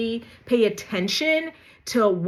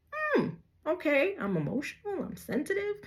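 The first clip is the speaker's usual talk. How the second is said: softer, dry mock agreement; sarcastic acceptance; 7 semitones higher, 7 dB quieter than usual. Answering the gaslighter's accusation, soft sarcastic mock agreement. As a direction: drop the voice and deadpan it sarcastically.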